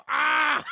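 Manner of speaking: high, loud "ah"; short hammed-up holler; mock yell. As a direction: yell it out as she dives in. Acting her dive into garbage.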